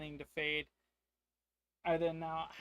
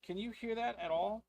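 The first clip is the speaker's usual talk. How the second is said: high, uneasy, straining to listen; anxious; 7 semitones higher, 4 dB louder than usual. Acted. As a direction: ask nervously about the noise. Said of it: "I go".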